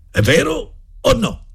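high insistent headmaster; raising his voice. A narrator's reading. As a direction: repeat it, pressing harder. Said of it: "alzando la voce".

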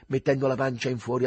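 ordinary delivery, nothing marked

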